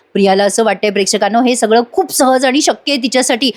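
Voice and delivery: ordinary delivery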